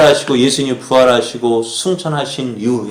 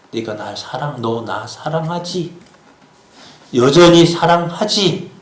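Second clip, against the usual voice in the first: acted tenderness, hushed at first; tender questioning; 2 semitones higher than usual. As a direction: ask gently, drawing the words out. Voices Jesus gently asking Peter.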